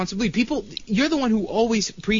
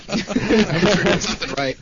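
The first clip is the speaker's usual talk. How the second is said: laughing; much slower than usual. Real, not acted. Laughs after being thanked.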